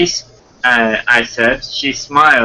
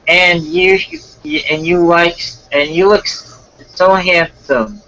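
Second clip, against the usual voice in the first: put-on higher-pitched girl paying a compliment; put-on girl character; girl's voice; 6 semitones higher than usual. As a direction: stumble through it in a raised girly voice. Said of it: "then I'll do the girl's voice".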